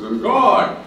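high, loud booming answer; a hammed-up God speaking from above; God's voice. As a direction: answer like a calm almighty voice.

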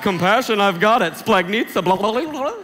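loud, high, show-off A student rattling it off; eager know-it-all student. Retold or imitated. Preacher mocks the eager A students shouting the answer. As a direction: ham up the keen student blurting the answer. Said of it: "Where's all my real A students? You're like".

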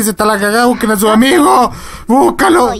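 high, rising exasperated call; exasperated urging. Real, not acted. Player urging game characters to find their friend, exasperated.

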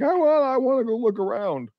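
high, whining dog voice; high-pitched whimpering dog; whiny dog voice. Retold or imitated. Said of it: "he's doing his whimpering and his moaning".

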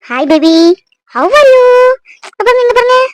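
high, bright-pitched cooing to an infant; high singsong greeting for a baby; baby talk. Acted.

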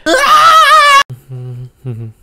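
high, loud wicked cackle; evil cackle. Acted. Villain laughing over the hiding player.

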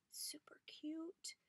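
high, slow whisper; soft whisper. Real, not acted. Vlogger admiring rolled fabric roses, soft high whisper. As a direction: murmur it in awe. Whispered asides over the gift.

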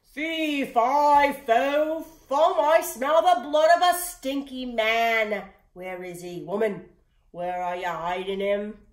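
a giant bellowing through his castle; booming giant. A narrator's reading. Giant bursts in, sniffing.

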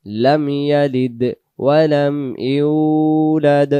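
drawn-out chanted tajweed recitation; Quran recitation. Acted. Teacher to students, reciting the sura aloud, chanted. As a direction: chant each verse slowly and melodically.

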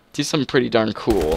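normal talking voice

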